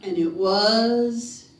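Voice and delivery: very slow, stretched words before the name; drawn-out monster announcement; dramatic reveal. A narrator's reading. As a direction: stretch it out, then land the name loudly.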